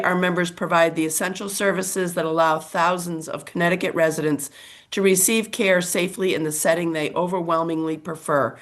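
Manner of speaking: ordinary talk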